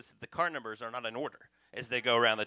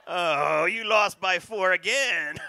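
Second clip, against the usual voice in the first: smug gloating; 4 semitones higher, 9 dB louder, much slower than usual. Acted. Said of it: "It's like".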